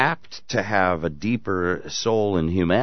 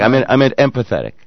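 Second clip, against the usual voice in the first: usual speaking voice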